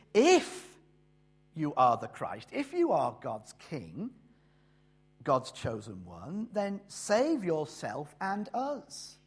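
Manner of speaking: loud, drawn-out jeer, the last words very slow; mocking taunt. The other thief jeering at Jesus from his cross, in a loud mocking taunt. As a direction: sneer it like a scornful condemned man.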